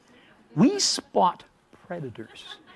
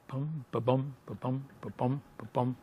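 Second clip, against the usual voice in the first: soft footstep rhythm; 4 semitones lower, 10 dB quieter than usual. Retold or imitated. Footsteps approaching closer.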